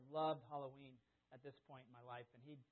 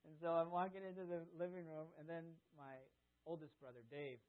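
usual speaking voice

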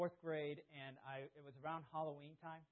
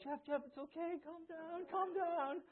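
high-pitched imitation of anxious soothing; urgent calming; 13 semitones higher, 3 dB louder, slower than usual. Family to screaming young Jeff, urgent high-pitched calming. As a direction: try to settle a hysterical child.

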